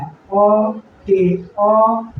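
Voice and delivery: ते, अ drawn out very slowly; slow syllable demo. Shown as a demonstration. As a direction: stretch ते then अ.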